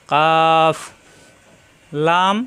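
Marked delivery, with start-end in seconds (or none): 0.1-0.8 কাফ with the tongue a bit forward on the palate; light model কাফ; Arabic letter kaf. Shown as a demonstration. Teacher models কাফ for students. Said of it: "তালুতে চাপ দিয়ে".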